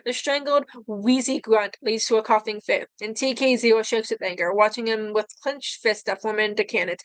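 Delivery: plain reading voice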